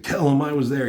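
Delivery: imitating someone pissed off, voice jumping up. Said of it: "He's just pissed off".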